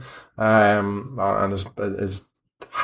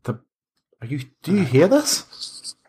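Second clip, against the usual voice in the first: startled priest; 3 semitones higher than usual. Acted. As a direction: voice rising in disbelief, hearing a ghost. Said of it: "and he goes".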